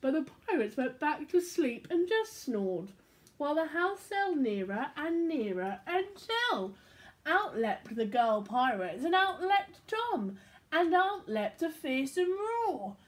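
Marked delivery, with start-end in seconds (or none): none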